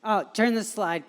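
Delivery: normal talking voice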